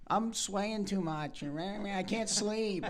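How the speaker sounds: slow, whiny teammate voice; whiny complaint. Retold or imitated. Mocking teammate who complains from the top bunk, slow and whiny. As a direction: drag it out like a whining bunkmate. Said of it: "up there complaining, like".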